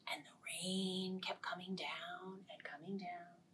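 whispered falling rain. A narrator's reading. Storyteller whispering the rain. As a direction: hush it down.

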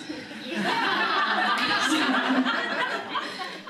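bursting into a high laugh; high falling laugh; big laugh. Real, not acted. Laughs at own joke.

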